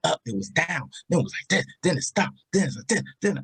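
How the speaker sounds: retold vocal swings, mostly high; voice swooping up and down. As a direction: bounce the pitch between phrases. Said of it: "then your voice was like".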